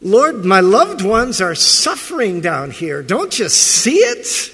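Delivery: loud, rising, desperate cry to God; mock anguished prayer rising high; loud accusing plea. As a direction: cry out at heaven, hurt. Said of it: "accusations like that".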